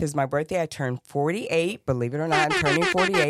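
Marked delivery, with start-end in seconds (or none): 2.5-3.3 excited. Real, not acted. Excited birthday announcement.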